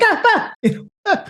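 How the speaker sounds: high squeaky yelps in a put-on voice; Charlie impression. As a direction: yelp like a cartoon kid. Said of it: "Like Charlie, like".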